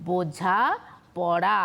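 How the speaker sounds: ordinary talk, nothing marked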